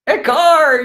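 high, loud game-show reveal; excited reveal. Pretend. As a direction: announce the answer to cheering kids. Reveals answer in card game.

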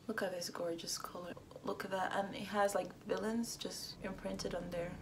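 awed unvoiced whisper; hushed whisper of admiration. Real, not acted. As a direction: breathe it in wonder. Awed over packaging.

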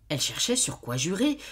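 plain reading voice

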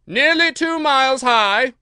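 high, slow, very loud screech; drawn-out queenly shriek; shrill accusation. Acted. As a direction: pile on as the imperious queen. Queen accuses Alice in court.

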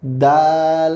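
দাল with the long vowel held; drawn-out letter. Shown as a demonstration. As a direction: stretch out the middle of দাল. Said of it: "একটু টেনে বলবো". (দাল একটু টেনে বলা)

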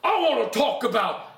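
high, hugely stretched revival shout; hammed-up pulpit cry with drawn-out vowels; mock shouting preacher. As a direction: holler it like an over-the-top evangelist. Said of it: "Or the others".

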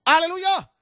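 high ringing alleluia shout. Real, not acted. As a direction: lift the crowd with a big alleluia. Preacher's shouted acclamation. (alléluia crié à pleine voix)

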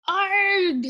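high, drawn-out mock death howl. Sunny pretends to be stabbed, theatrical howl. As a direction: howl as if struck in a play fight. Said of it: "Sunny howled".

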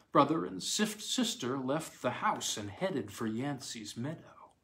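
usual speaking voice

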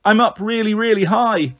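put-on high-pitched little girl's squeal, understated; lightly voiced scared child up high. A narrator's reading. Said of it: "Bliss squealed".

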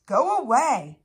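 loud, high shooing; cross dismissal. A narrator's reading. Mouse shoos Sparrow away, cross and loud. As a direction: send them off crossly and loudly.

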